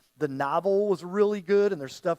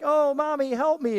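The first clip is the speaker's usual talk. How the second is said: high, louder pleading little girl, mock horror film; scared little girl; 8 semitones higher, 7 dB louder than usual. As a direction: beg mommy like a possessed child in a movie. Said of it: "give it the creepy voice".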